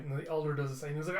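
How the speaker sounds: ordinary delivery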